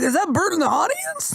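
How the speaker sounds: high-pitched mock surprised question; imitating excited onlookers. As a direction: pipe up like a fan spotting a celebrity. Imitating excited onlookers spotting a lookalike.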